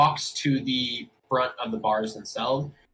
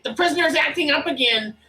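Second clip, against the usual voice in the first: very high, squeaky goblin guard; cowed little creature calling for help; whimpering goblin; 4 dB louder, faster than usual. Acted. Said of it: "like, whimper a little bit".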